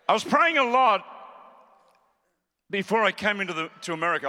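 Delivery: loud, high-pitched revival proclamation; full-force preaching. Real, not acted. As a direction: proclaim it to the whole congregation at full volume.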